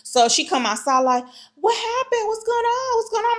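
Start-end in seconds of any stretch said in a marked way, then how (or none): 1.5-3.4 high-pitched mock innocence, lightly tossed out; nonchalant friend. Michelle strolling outside after the commotion, mock nonchalant. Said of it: "she's acting nonchalant about it".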